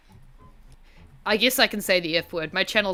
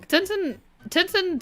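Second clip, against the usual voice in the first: sing-song; 11 semitones higher, slower than usual. Real, not acted. Playful sing-song name call.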